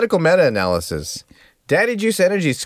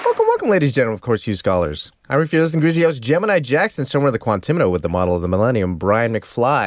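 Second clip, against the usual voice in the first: announcer; 3 semitones lower, faster than usual. Acted. Hosts the show intro.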